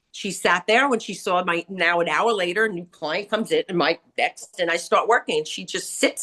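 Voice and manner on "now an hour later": ordinary talk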